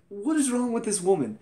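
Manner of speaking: high-pitched, incredulous complaint; exasperated disbelief. Acted. Reviewer baffled by the actress, exasperated.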